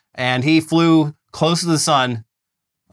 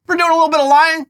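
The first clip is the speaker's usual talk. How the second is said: much higher pitch, incredulous question; fired-up mock outrage; 11 semitones higher, 3 dB louder, faster than usual. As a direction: protest in high-pitched mock disbelief. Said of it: "I got a little fired up there".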